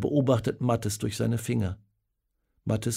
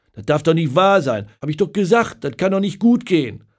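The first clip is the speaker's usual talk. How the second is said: loud, higher, exasperated complaint; upset outburst; 6 semitones higher, 8 dB louder than usual. Acted. Paul at the pub watching Germany lose, loud upset. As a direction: vent at the screen like a let-down fan. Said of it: "Paul regt sich auf".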